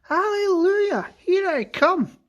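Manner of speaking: put-on high, wild old crone crowing; gleeful crowing. Grandma crows in glee rising through the room. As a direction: crow it out high like a giddy hag.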